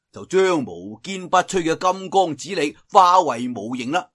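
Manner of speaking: ordinary delivery, nothing marked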